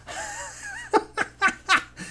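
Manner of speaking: a sudden high-pitched burst of laughter; high squealing laugh. Real, not acted. Streamer laughs at the cat on camera, high squeal.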